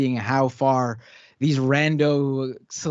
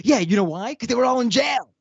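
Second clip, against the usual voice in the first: higher-pitched retold comeback, rising in exasperation; heated retort, pitch climbing at the end; exasperated retort; 7 semitones higher than usual. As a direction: fire back the obvious answer, exasperated. Retells own exasperated retort in argument.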